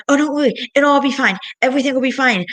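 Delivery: a hint of a dismissive mother; brushing off her daughter, lightly mock-reassuring; imitating mom. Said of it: "she was like".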